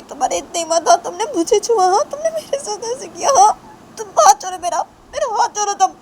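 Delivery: high, loud, indignant outburst; angry Shanaya. Acted. Shanaya to Tisha, demanding her hand be let go, angry.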